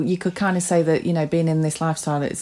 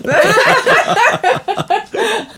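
high giggle fit; 14 semitones higher, 4 dB louder than usual. Real, not acted. Fits of the giggles.

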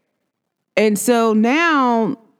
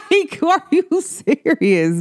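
giggly, slowed, high laugh; delighted giggling; 9 semitones higher, slower than usual. Real, not acted. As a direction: laugh through it. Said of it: "My voice just goes crazy".

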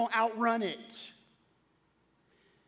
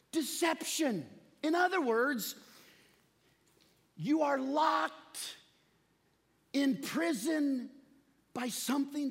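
preaching; 6 semitones higher than usual. Acted. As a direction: build the sermon to a climax. Preacher building to full force.

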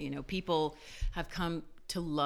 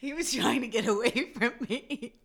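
laughing; 10 semitones higher, 11 dB louder than usual. Real, not acted. Laughing hard at own story.

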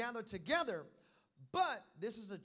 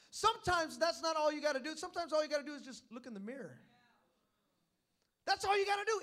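usual speaking voice

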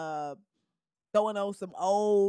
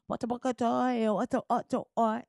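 lightly faked Portuguese gibberish, sultry drawl; mock Portuguese drawl; 2 semitones higher than usual. Imitating Brazilians at the beach, mock Portuguese drawl. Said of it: "sexy drawl of Portuguese".

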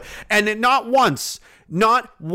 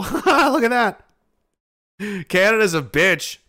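mocking sneer; much slower than usual. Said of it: "didn't just see this and go".

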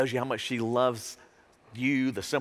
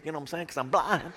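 normal talking voice

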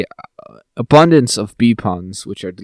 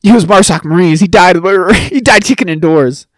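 mock earnest soldier sister; 5 semitones higher, 5 dB louder than usual. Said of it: "she's like".

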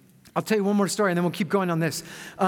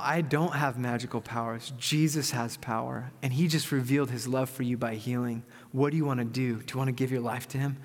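low, soft reassurance; calm, sincere; 6 semitones lower, 4 dB quieter than usual. Retold or imitated. Pastor answering the amazed healed man, calm and sincere. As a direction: answer softly and low, earnest.

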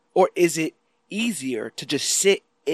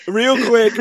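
laughing; 7 semitones higher, 9 dB louder than usual. Real, not acted. Repeats laughing.

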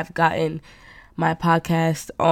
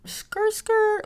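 high, sudden squealing screech like brakes; much slower than usual. Retold or imitated. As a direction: shriek it like tyres skidding to a halt. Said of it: "And I was like".

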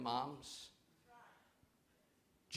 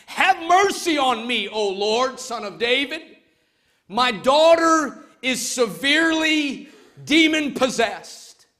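loud, higher plea for mercy; pleading cry; 9 semitones higher, 11 dB louder than usual. Acted. Canaanite mother begging Jesus, a loud pleading cry. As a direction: cry out to him for help. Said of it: "cried out to him".

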